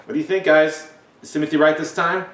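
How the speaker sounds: ordinary talk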